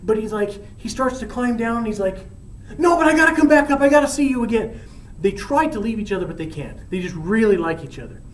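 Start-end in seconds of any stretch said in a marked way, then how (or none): 2.8-4.8 eager. Acted. Romeo rushes back up.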